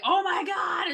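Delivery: loud, high startled cry; shocked gasp. Retold or imitated. Rose sees Carl pull his face, shocked cry. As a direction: react in horror.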